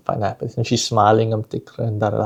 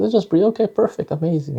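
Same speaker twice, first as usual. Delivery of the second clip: cheerful praise; 7 semitones higher than usual. Retold or imitated. Praising calmer child.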